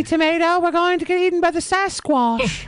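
high-pitched mock-alarmed cartoon voice; cartoon vegetable voice. Co-host voices a VeggieTales hero facing the villain, high mock alarm. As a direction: play a panicked little kids'-cartoon character. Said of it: "main antagonist in VeggieTales".

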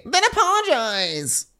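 very high, slow demand; mock Lindsay. Co-host mocks Lindsay demanding an apology, very high. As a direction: drag out the demand haughtily.

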